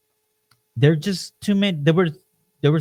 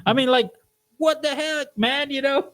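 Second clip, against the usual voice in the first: exasperated disbelief; 11 semitones higher than usual. Real, not acted. Exasperated outburst.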